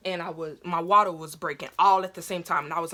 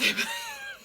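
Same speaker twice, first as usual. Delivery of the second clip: very high-pitched wailing squeal; imitated labor scream, thin and high; mock scream. Screaming in the waiting room, high mock shriek. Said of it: "I'm screaming".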